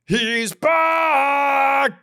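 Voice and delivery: high, drawn-out shouted He's back; meme announcer shout. Retold or imitated. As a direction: belt it like a hype meme announcer. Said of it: "it's just".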